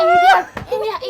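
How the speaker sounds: high, loud shouted word; furious shout. Acted. Mother shouting back at her daughter who called her an idiot.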